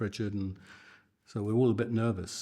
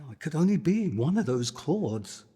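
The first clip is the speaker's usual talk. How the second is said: much higher and louder, indignant; exasperated retort; 6 semitones higher, 6 dB louder than usual. Retold or imitated. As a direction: fire back, fed up. Retorting about the guitar chords.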